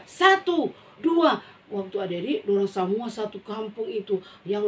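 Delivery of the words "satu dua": high, loud count. Acted. Villagers counting before the pull. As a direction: call out the count.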